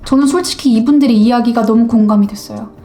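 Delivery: usual speaking voice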